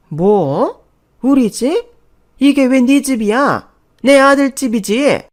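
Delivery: high, very loud indignation; outraged disbelief. Acted. Mother-in-law disputes whose house it is, outraged. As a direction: throw the words back, scandalised and shouting.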